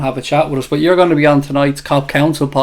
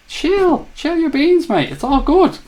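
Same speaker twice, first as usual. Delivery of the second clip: much higher, squeaky-high pitch, normal volume; high-pitched chiding; 13 semitones higher, slower than usual. Real, not acted. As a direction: tell someone to calm down in a very high voice. Telling an upset commenter to relax.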